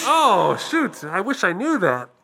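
high, disappointed customer voice; silly high voice. Pretend. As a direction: whine like a ditzy customer. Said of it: "I don't sound like this".

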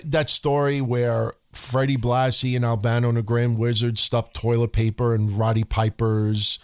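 normal talking voice

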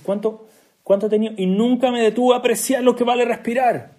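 high, loud retold lament; impassioned realization. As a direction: build to a pained outcry. Retold old man's tearful realization.

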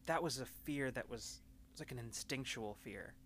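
soft, hesitant confession with long pauses; quietly troubled, feeling for the words; subdued, preoccupied Alma. Acted. Alma admits what she's been thinking about, hesitant and subdued.

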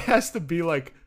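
ordinary talk

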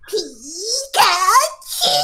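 high, very slow drawn-out Pikachu cry. Pretend. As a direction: stretch out a squeaky Pikachu. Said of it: "I had to get further away from the mic".

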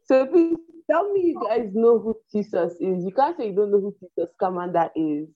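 ordinary delivery

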